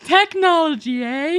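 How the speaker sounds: high, slow, hammed-up fuddy-duddy marvelling; mock old-fashioned voice. Mocking the early mobile phone era, high slow old-timer voice. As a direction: play a bewildered old-timer amazed by gadgets.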